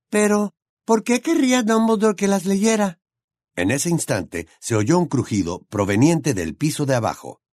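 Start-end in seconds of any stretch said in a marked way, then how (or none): 0.1-3.0 put-on high, bright young witch voice; Hermione's voice. A narrator's reading. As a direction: voice the clever girl friend.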